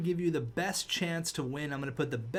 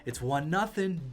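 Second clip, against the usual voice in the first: normal talking voice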